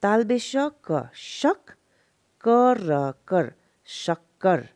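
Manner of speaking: spelling-out demo with pauses between शक and कर; separate syllables. Shown as a demonstration. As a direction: slowly sound out शक, then कर, then शक्कर. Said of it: "तब हम अलग से करेंगे".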